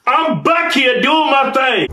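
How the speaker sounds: loud, high, slow protest; defiant shout. Real, not acted. Nagged about scratching his head, defiant shout. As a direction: shout each word in self-defence. Defends himself when nagged on camera.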